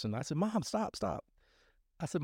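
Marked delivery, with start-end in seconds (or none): 0.4-1.3 high pleading "stop, stop"; exasperated stop. Retold or imitated. Son cutting off his fussing mom, high exasperated plea. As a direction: cut her off, voice jumping high.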